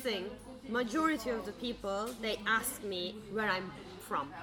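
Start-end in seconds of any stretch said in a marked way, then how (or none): none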